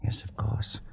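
breathy unvoiced whisper; whispered bitterness. Pollock whispering back to his disbelieving friend. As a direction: barely breathe the words out.

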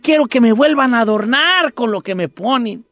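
high, earnest begging voice; pleading little tree. Acted. Tree begs the man with the axe, pleading.

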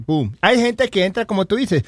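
ordinary talk, nothing marked

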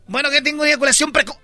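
mock Puerto Rican accent, fast. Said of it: "En Puerto Rico dicen".

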